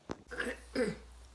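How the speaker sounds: clearing the throat; quick throat clear. Real, not acted. Clears throat before speaking.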